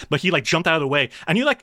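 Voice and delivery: ordinary delivery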